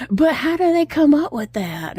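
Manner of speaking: a hint of the boy's disbelief at the judges; protesting kid. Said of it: "And he's like".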